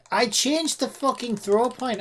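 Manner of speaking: frustrated. Real, not acted. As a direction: vent at the code. Frustrated at the game.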